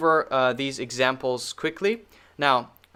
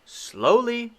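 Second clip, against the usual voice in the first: mock slow; 10 semitones higher than usual. Shown as a demonstration. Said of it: "I'm gonna read it as usual".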